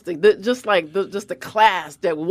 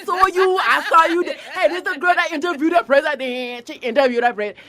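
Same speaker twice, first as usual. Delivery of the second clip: excited airport agent; 8 semitones higher than usual. Retold or imitated. Airport security agent greeting Glozell, high and gushing. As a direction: gush like an agent who just saw you on TV. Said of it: "T S A was like".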